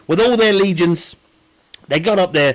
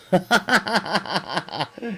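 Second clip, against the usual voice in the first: real laugh; 5 dB quieter than usual. Real, not acted. Streamer amused by own history theory, laughing. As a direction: laugh in a run of quick bursts.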